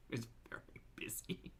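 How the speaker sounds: whispered dry aside; sarcastic whisper. Acted. A host whispers mockery of a laundry video. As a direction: whisper it like a secret joke.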